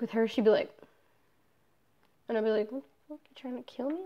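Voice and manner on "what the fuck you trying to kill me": hushed, disbelieving aside; retold alarmed muttering. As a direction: mutter it under your breath, as if unnerved. Retold private reaction to threatening stare.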